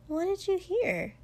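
high-pitched, puzzled question; puzzled Mina. A narrator's reading. Friend asks what he overheard, high and puzzled. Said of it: "Mina looked at him strangely".

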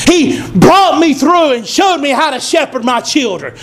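usual speaking voice

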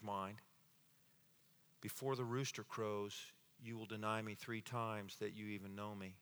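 low, soft and slowing, with heavy pauses; hushed, solemn reading. A narrator's reading. Preacher reading Peter's bitter weeping, hushed and solemn. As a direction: let the tragedy sink in quietly.

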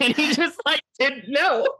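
high, squeaky laughing delivery; cracking up, stretched out and high; amused disbelief. Real, not acted. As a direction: squeeze it out through laughter, voice way up. Cracking up at own story.

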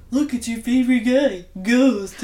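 loud mocking sing-song tease; teasing loud quote. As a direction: call it out loud to tease him.

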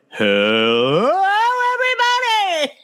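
mock two-octave jump greeting; octave jump imitation. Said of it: "You were like".

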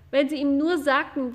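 ordinary delivery, nothing marked

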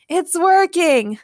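high, drawn-out yell. A narrator's reading. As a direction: shout good news to a friend. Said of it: "James yelled to Logan".

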